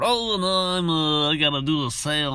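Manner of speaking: high, excuse-making voice; mock salesman ducking work; dodging salesman. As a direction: weasel out of the cardboard. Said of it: "Can you come help me".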